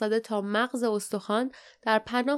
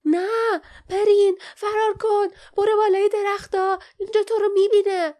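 loud, high, urgent yelling; shouted warning; 11 semitones higher, 7 dB louder than usual. A narrator's reading. Lief warns Perin to flee up the trees, urgent shout. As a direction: yell a frantic warning to a friend in danger. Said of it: "لیف فریاد زد".

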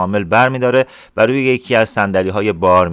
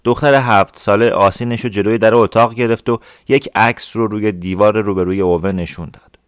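ordinary delivery, nothing marked

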